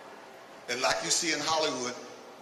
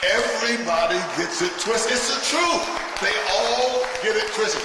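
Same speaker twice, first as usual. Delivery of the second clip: louder, pressing the point home; louder insistence; 6 semitones higher, 6 dB louder than usual. Acted. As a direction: hammer the line home to the crowd. Repeats the line, now shouting.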